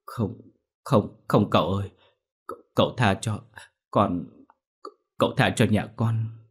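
put-on tearful begging to the young master; pleading through tears. Girl begs the young master, tearful plea. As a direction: beg him to spare the family, voice wet with tears. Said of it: "rưng rưng nước mắt".

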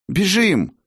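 high urgent yell to run; urgent shout. Acted. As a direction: shout it out in alarm. Fleeing collapsing crystal, call to run.

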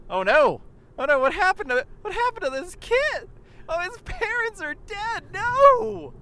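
high, loud hammed-up panic; mock screaming. Friends mocking a show crowd, shrill mock wail. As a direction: wail it like a clueless fan in falsetto. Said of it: "we were screaming".

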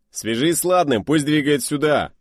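high, loud urgent command; shouted order. Acted. Pilot shouting an order to the radio man. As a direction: bark the order over the engines.

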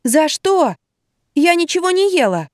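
high, indignant protest; can't believe the bill; outraged. A narrator's reading. Narrator protests being charged, high and indignant.

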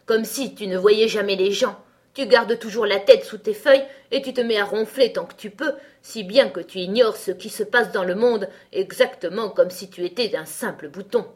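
loud tirade; cross scolding. A narrator's reading. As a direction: scold loudly, as an angry flower. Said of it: "s'écria le lys tigré". (réprimande forte et fâchée)

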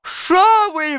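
very high, drawn-out wail; piercing high cry of a man being beaten; thin-voiced howl. A narrator's reading. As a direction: wail it thinly in protest. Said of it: "взвыл тонкий голос".

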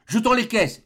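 rally the others to throw the crates; urgent call. Acted. Kennedy urges dumping crates.

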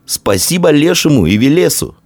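high, happy thanks; delighted boy's cry; joyful exclamation. A narrator's reading. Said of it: "радостно воскликнул Идарий".

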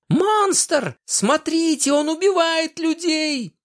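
high, shrieking panicked woman; hysterical scream. A narrator's reading. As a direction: scream it out in terror. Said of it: "Истерично завопила она".

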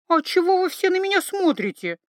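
high, squirming protest; uneasy high voice. A narrator's reading. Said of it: "Даже поёжилась Ива".

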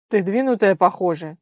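loud gruff grumble; grumbling man. Acted. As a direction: grumble it out, annoyed and rough. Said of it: "недовольно проворчал мужской голос".

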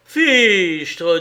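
loud, startled oath. A narrator's reading. Husband hearing the sum spent, startled. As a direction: burst out, taken aback.

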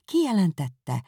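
ordinary delivery

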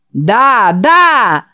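loud, rising да, да; loud echoing yes. Acted. The preacher echoes the boy with a loud yes. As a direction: echo the shout eagerly. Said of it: "повторил за ним преподобный Гарднер".

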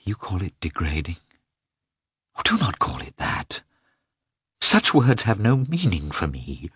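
shaky, a breathy high plea; tremulous. A narrator's reading. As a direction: let the voice tremble. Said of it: "she replied tremulously".

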